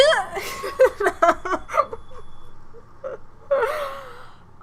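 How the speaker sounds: high, crying laugh; squealing laugh half crying; helpless laughter. Real, not acted. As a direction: break down laughing at the disaster. Disaster right after shouting.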